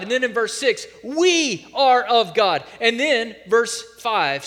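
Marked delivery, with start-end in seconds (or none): 1.0-2.6 "We" hit hard, said slowly and loudly; slow emphatic "We are of God"; stress on We. Shown as a demonstration. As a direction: declare "We are of God" with weight. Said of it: "emphatic declarations".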